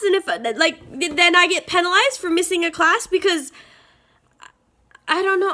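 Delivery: high-pitched, loud exasperated complaining; real frustration spilling out, voice strained high; stressed rant. Real, not acted. College student venting to viewers about missing syllabi, exasperated high rant. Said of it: "I'm so stressed".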